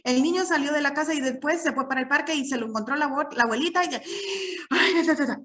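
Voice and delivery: run-on demo of bad reading, trailing into babble; rushed, pauseless reading. Shown as a demonstration. Teacher showing students how not to read a poem, rushed and pauseless. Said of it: "usted no lo va a leer así".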